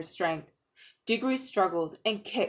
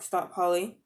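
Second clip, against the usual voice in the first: plain reading voice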